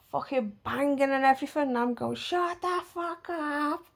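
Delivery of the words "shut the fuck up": mock yell. Kept awake by banging, a high mock yell at neighbour. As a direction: imitate yelling through the wall, held back. Retells yelling at noisy neighbour.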